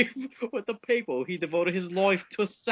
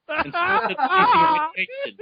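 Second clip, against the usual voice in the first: laughing; 5 dB louder than usual. Real, not acted. Laughing mid-sentence.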